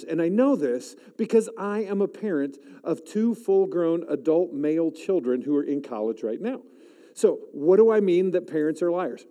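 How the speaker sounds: ordinary delivery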